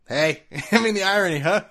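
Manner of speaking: chuckling through the words; laughing. Real, not acted. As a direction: laugh it off amused. Laughing at Papa John's irony.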